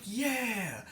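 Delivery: sudden high whooping yeah; excited cheer. Retold or imitated. Group cheers the free healthcare speaker, excited high yeah. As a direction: cheer along with the crowd. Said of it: "And everybody in the group's like".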